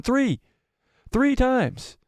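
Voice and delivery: high, hyped emphasis on three; excited emphasis. Real, not acted. Streamer hyping chat about the deaths, excited. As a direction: hammer home the count, high and excited. Stressing how often she died.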